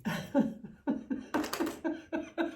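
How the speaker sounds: breathless nervous giggle; high airy nervous laughter. Real, not acted. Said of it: "c'est un rire nerveux". (rire nerveux)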